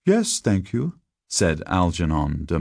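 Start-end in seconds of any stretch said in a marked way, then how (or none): 0.0-1.0 high, polite schoolboy voice; well-mannered boy, higher voice. A narrator's reading. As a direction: answer politely, pitched up like a boy. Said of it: "with native politeness".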